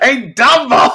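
a loud, high, rowdy call of Dumbo; mock yell. Boss shouting a nickname at his driver from the limo, hammed-up yell. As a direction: bark the nickname up and out.

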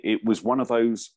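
ordinary talk, nothing marked

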